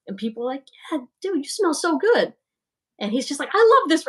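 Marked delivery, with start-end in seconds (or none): 0.9-2.3 high-pitched admiring party guest; imitated gushing. Streamer voicing guests praising dad's fragrance, imitated gushing. 3.5-4.0 loud, high burst of enthusiasm; imitated delight. Streamer voicing dad loving the gifted fragrance, imitated delight.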